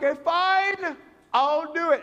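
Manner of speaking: high, loud mock surrender; exasperated giving in. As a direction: give in loudly to a relentless pest. Giving in to relentless nagging.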